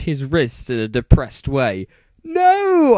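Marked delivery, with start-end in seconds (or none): 2.2-3.0 very high, loud cry of no; scream. A narrator's reading. Ebony screaming at her vision. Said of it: "I screamed sexily".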